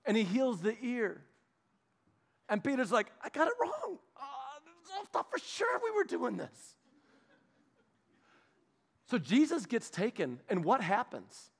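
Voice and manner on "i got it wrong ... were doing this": higher, softer, embarrassed little voice with a laugh; sheepish mumble. Retold or imitated. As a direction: shrink back after getting it wrong. Peter sheepish after rebuke.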